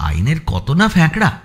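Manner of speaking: ordinary delivery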